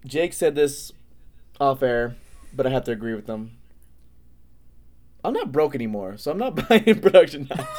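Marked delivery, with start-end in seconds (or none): 6.2-7.8 laughing mid-sentence. Real, not acted. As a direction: breaks into a high laugh. Joking about being broke.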